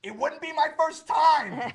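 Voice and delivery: high, loud, slow mock yell; big shout back. Staying in character, hollering back at the cop, slow defiant yell. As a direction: belt it back slowly, all bravado. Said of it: "I shout back".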